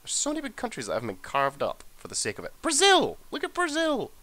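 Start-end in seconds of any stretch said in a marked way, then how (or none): 2.6-4.1 much higher, loud on "Brazil!"; high-pitched amazement at the map; excited shout. Real, not acted. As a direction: gasp out the surprise in a high voice.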